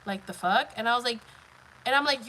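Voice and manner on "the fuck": ordinary delivery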